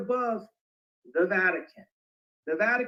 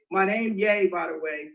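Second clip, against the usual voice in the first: ordinary talk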